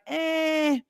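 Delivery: drawn-out high "eh"; high noise of mild objection; doubtful "eh". Retold or imitated. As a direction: let out a long, high, unconvinced "eh". Voices his generation's doubtful reaction.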